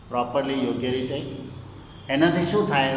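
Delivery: usual speaking voice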